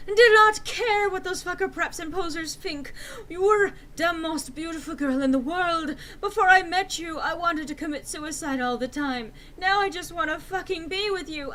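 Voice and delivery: loud, high passionate speech; Draco's declaration. Acted. Draco confessing love to Enderby, loud high. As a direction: pour it out loud and dramatic. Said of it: "he shouted sadly".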